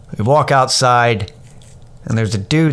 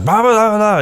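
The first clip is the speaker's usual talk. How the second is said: mocking blah blah; 11 semitones higher, 3 dB louder than usual. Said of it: "And he's like".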